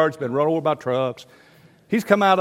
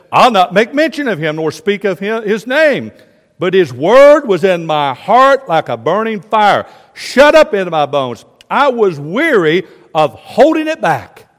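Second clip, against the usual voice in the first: loud, raised preaching voice; impassioned scripture reading; 4 semitones higher, 8 dB louder than usual. Acted. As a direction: cry out the prophet's complaint. Reads Jeremiah at full force.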